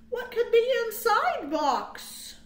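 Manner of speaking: ordinary delivery, nothing marked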